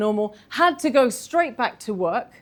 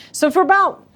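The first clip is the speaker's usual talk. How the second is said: usual speaking voice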